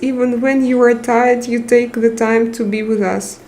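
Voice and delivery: ordinary talk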